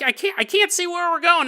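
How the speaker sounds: high, panicky squeak; panicky Bill Gates. Retold or imitated. Pilot Bill Gates panicking in the dark, high and panicky. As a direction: fret that you can't see a thing. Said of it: "Bill Gates is like".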